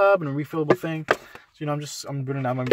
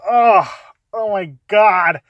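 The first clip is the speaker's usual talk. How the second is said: loud, drawn-out "oh my God" in disbelief; shocked disgust; 6 semitones higher, 9 dB louder, much slower than usual. Retold or imitated. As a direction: gasp it out, appalled at the foot. Disgusted at neighbour's bare foot.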